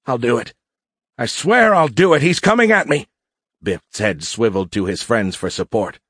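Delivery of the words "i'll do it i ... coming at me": building to a high, loud panic; panicked. A narrator's reading. Cadet swears to fire as the warrior nears, panicking. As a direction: escalate into a high, frantic cry.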